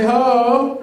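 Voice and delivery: shouted "Hey" for the crowd to echo; loud call. Shown as a demonstration. Speaker leading a crowd chant, shouting. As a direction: belt it like a stadium chant. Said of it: "Y'all see how that was louder?".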